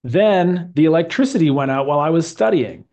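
a teacher's reading aloud for the class to echo; model reading. Shown as a demonstration. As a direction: read it clearly with a careful rise and fall in the voice. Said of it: "listen to the way I say it".

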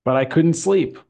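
a teacher's reading aloud for the class to echo; model reading. Shown as a demonstration. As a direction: read it clearly with a careful rise and fall in the voice. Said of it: "listen to the way I say it".

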